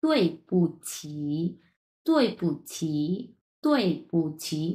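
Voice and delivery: "তৈ পুচ্ছি" slowly, syllable by syllable; careful repeated model. Shown as a demonstration. Teacher repeats a new word for clarity, slow and separated. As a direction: draw out each part of "তৈ পুচ্ছি". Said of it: "আমি একটা word দুই থেকে তিনবার উচ্চারণ করছি".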